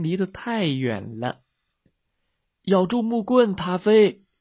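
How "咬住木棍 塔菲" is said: loud, raised-pitch call; shouting. A narrator's reading. Boater to the dog drifting away, shouting. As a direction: shout the instruction high and loud. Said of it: "划船的人喊".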